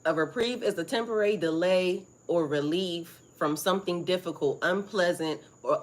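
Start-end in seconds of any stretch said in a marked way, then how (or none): none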